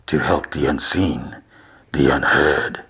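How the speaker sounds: low, eerie intoning, spaced apart; echoing voice. Acted. Host explaining why he is here, low echoing voice. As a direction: drop low and let each phrase echo.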